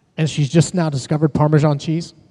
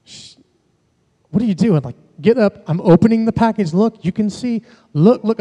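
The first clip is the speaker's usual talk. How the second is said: put-on frantic coaxing of a toddler mid-fit; dad pleading with his daughter to look, higher and urgent; exasperated dad; 6 semitones higher than usual. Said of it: "I'm looking at Carrie going".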